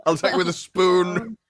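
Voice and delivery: high, drawn-out silly threat; mock threat. Holdout threatening the bodyguards with a spoon, mock threat. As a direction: threaten slowly in a high put-on voice.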